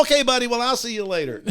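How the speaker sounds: hurried hearty brush-off; flustered retreat. Retold or imitated. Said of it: "He goes".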